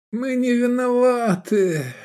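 high, slow, drawn-out whine; mumbling. A narrator's reading. Hungover Edik excusing himself, high slow mumble. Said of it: "промямлил Эдик".

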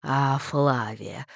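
low, slow vicar voice; genial vicar. A narrator's reading. Vicar greets Flavia at the church door, low and slow.

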